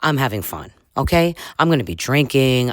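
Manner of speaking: normal talking voice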